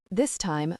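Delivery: ordinary delivery, nothing marked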